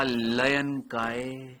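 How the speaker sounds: very slow, drawn-out syllables; unhurried, long-held vowels; slowed-down speech. Real, not acted. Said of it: "वो धीरे हो जाती है".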